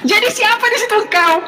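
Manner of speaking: high-pitched, pressing question; exasperated demand. Real, not acted. Debater pressing opponent for an answer, exasperated.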